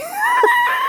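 a short, very high squeal of laughter; shrieking laugh. Real, not acted. Host squealing with laughter at the punchline. Cracking up.

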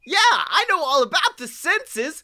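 very high cartoon creature voice; Figment. Little creature cutting into the chairman's tour, high squeaky boast. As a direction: pipe up eagerly in a squeaky character voice. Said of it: "I've gotta be Figment".

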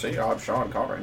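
low, soft, muffled mumble. Pretend. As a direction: talk behind a hand over the mouth. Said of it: "just with a muffled voice".

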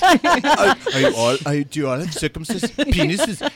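put-on high, loud South African accent. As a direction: mimic a South African tourist. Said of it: "That's my terrible South African accent".

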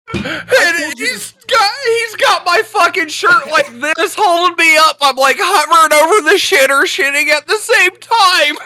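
high, loud, laughing through words. Real, not acted. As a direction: keep laughing as you tell it. Laughing while telling story.

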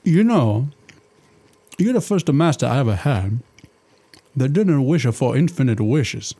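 put-on thick comic Italian genie accent; a jolly Italian genie, hammed-up accent. Genie chatting to his master in a hammed-up Italian accent.

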